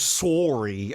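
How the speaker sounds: Sorry as SORE-ee, drawn out; Canadian pronunciation. Retold or imitated. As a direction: imitate a Canadian Sorry with a long OR. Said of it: "gets really Canadian sometimes and goes".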